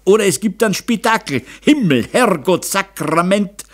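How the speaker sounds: angry, high-pitched Bavarian tirade; furious father. A narrator's reading. Father threatens a row over the family's shame, furious. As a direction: rage at the household, building to a curse. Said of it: "wütenden Blick zu".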